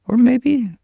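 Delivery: jumps way up high and loud; a playful high-pitched squeak; squeaky high voice. Acted. Sudden high silly pitch.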